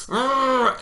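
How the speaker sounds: loud, high imitated groan of exasperation; mock frustrated growl. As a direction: let out an exaggerated cry of frustration. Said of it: "And he's like".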